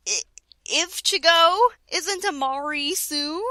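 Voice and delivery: normal talking voice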